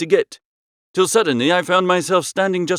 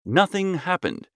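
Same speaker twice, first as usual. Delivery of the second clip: usual speaking voice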